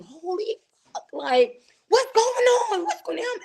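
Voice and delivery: reenacted hysteria, pitched very high; shriek-high panic, retold without much volume; hysterical. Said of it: "And now I'm hysterical".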